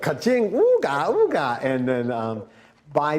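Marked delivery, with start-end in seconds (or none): 0.0-1.6 high, slow cartoon cash-register voice; cartoon money-eyes. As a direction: ham up a greedy cartoon ka-ching. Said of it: "and he was like".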